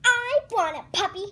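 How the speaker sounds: loud, high, quick demand; insistent loud whine for it; demanding tantrum. Acted. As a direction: throw a little kid's tantrum. Pigeon throws a tantrum.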